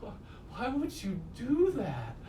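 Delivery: high, slow, baffled voice of a drugged man; dazed bewildered whine. Retold or imitated. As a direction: stretch it out, stunned and hurt. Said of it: "then he's just like".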